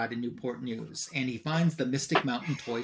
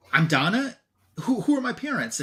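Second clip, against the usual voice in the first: raised pitch, louder retold amazement; amazed questioning; 9 semitones higher, 5 dB louder than usual. Donna asking Elmira about her parents, amazed questioning. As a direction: stumble into the question, voice jumping up.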